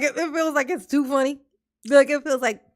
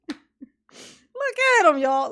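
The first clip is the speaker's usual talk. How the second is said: laughing; 6 semitones higher, much slower than usual. Real, not acted. Laughing at defendant.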